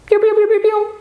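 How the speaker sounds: very high little cheeps; chick peeping. Pretend. Teacher acting out chicken sounds, chick peeping. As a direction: peep like a baby chick.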